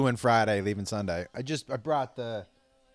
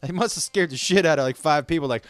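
normal talking voice

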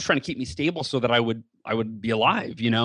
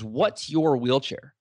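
ordinary talk, nothing marked

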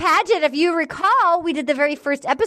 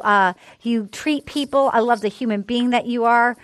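ordinary delivery

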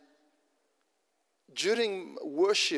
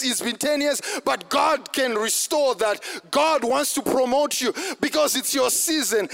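higher, driving sermon delivery; fervent preaching; 6 semitones higher, 5 dB louder than usual. Real, not acted. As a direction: preach it hard, phrase after phrase. Preaches at full force.

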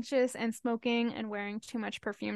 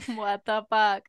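slow, louder disbelief; disbelieving; 7 dB louder, slower than usual. Real, not acted. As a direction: let it drop in disbelief. Disbelief at news.

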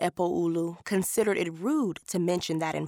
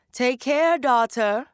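higher, louder call across the market; 6 semitones higher, 5 dB louder than usual. A narrator's reading. Old woman calling to a passing girl, raised call. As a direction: call out to catch the girl's attention. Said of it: "someone called".

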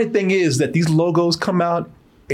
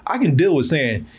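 ordinary delivery

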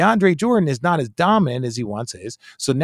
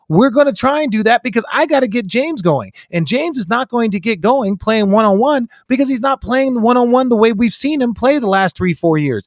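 exasperated high-pitched coach; whiny coach pleading his case; coach impression; 8 semitones higher than usual. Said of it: "He's like".

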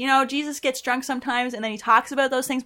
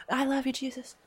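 soft murmur; 4 semitones higher, 6 dB quieter than usual. Acted. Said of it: "Don't whisper in my ear like that".

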